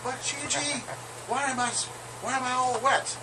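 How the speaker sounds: high mock female voice, breathless; hammed-up high woman's voice; falsetto woman voice. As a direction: squeal it like a giddy lady. Woman's voice again.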